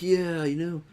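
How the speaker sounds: happy, enthusiastic gamer voice. Retold or imitated. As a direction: play up being cheerful and having fun. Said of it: "I was being enthusiastic".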